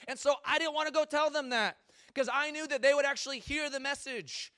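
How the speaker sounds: high, loud indignant complaint; acted outraged rant at God; mad, upset. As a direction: protest like a pouty, angry prophet. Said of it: "He is mad. He's upset".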